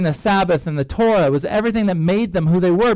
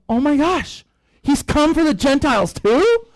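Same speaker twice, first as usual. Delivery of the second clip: higher mock astonishment; shocked; 7 semitones higher than usual. Elders hear Gentiles got the Spirit, mock shock. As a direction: gasp it out like a revelation. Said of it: "there's this utter shock".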